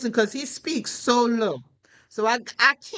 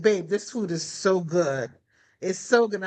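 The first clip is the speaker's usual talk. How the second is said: much lower, mellow imitation of her husband; husband's praise; 6 semitones lower than usual. Husband praising her dinner, in a lower imitated voice. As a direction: drop low like her husband savoring dinner. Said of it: "he's like".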